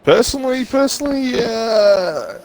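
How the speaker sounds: very high, squeaky drawl easing down; high put-on voice. Giving the lads his personal view, high put-on voice. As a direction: start way up in falsetto, then sink.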